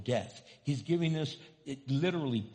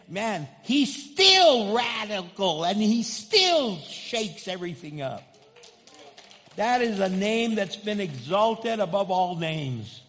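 louder, slower preaching; fiery preaching; 6 semitones higher, 9 dB louder than usual. Real, not acted. Preacher exalting the name above all names. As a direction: shake the room with it.

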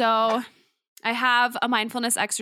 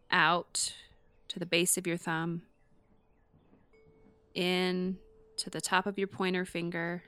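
guided meditation; 3 dB quieter than usual. Acted. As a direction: gently talk them through each breath. Leads a palm-tracing breathing exercise.

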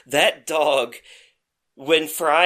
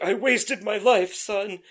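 put-on dying old man; 4 semitones higher than usual. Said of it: "and he's like".

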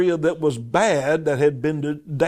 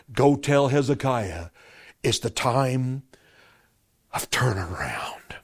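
God's message to Hezekiah, whispered; whispered divine word; 3 semitones lower, 3 dB quieter than usual. Acted. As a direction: let God's word fall to a hush.

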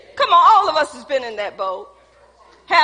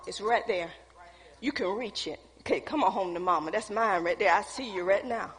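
low, very soft coaxing; coaxing murmur; 7 semitones lower, 10 dB quieter than usual. Acted. Preacher playing someone luring what they want, coaxing murmur. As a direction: coax it home, soft and low. Voices wanting a tempting thing.

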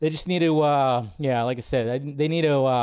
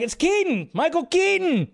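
yelled out; 12 semitones higher than usual. Retold or imitated. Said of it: "I just yelled it out".

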